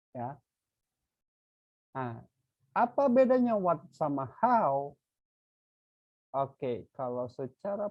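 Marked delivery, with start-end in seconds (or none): 2.7-4.9 raised question. Real, not acted. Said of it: "teacher asks class, raised voice".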